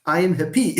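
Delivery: "happy" said hap-PY, end punched; misplaced final stress. Shown as a demonstration. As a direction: wrongly stress the second syllable of "happy". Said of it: "since you pronounce happy as happy".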